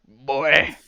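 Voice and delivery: a touch of gruff game hero; mock-stern one-word callout; lightly voiced epic scolding; God of War impression. Shown as a demonstration. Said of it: "God of War there".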